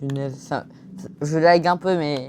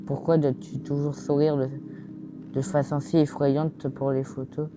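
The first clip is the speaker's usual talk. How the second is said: ordinary talk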